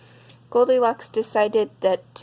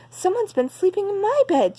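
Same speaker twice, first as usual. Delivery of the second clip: higher mother bear exclamation; exclaimed; 10 semitones higher than usual. Acted. Mommy Bear checks her chair, higher mild exclamation. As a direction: voice Mommy Bear up, a little surprised. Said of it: "she said".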